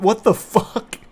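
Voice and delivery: ordinary delivery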